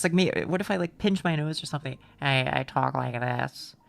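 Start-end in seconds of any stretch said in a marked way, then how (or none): 2.2-3.8 lower, slow, lightly pinched smoker voice; a hint of an old secretary; mock smoker voice. Shown as a demonstration. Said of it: "I don't know how to do the smoker voice".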